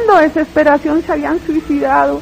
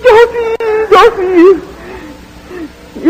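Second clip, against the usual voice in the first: much higher shout, fading over the repeats; shouted protest; 7 semitones higher, much slower than usual. Retold or imitated. Answering the voice that judges her: desperate shout. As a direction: insist desperately that you have loved. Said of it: "Yo grité".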